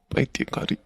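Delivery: whispered, to himself; fond whisper. Acted. Husband whispering about his crying wife, fondly. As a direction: breathe it out fondly under the breath. Fond aside after phone call.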